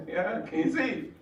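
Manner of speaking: high, amused laughter through words; chuckling punchline; laughing. Real, not acted. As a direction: laugh at the absurdity. Laughs at own point.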